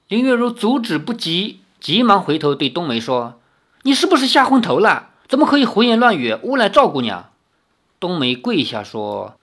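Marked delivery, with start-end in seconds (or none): 3.8-7.3 higher, louder and fast rebuke; scolding. A narrator's reading. Yueru scolds the maid for slander, sharp and fast.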